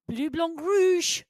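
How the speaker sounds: exaggerated French pronunciation; hammy French accent. Shown as a demonstration. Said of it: "in my best French accent".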